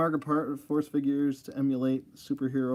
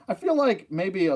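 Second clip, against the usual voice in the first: ordinary delivery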